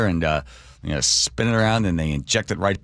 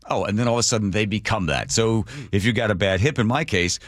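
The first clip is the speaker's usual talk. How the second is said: ordinary delivery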